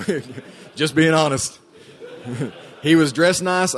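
amused confession, can't hold back the laugh; laughing while telling. Real, not acted. Telling a friend about the rich guy, laughing while admitting it. Admitting he expected a free meal.

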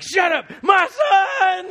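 high, loud, slow overjoyed yell; father cutting off the son's speech with a happy scream; joyful scream. Retold or imitated. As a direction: drown out the apology with joy. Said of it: "he starts screaming".

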